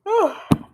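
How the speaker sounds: high sighing yawn; big yawn. Real, not acted. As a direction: let out a long yawn. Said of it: "Sorry for all the yawning".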